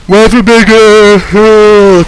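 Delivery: slow, loud, high yawned words; yawning. Real, not acted. Tired commentator yawning loudly at the viewers. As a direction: let a huge yawn swallow it. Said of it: "keep yawning".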